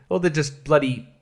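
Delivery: ordinary delivery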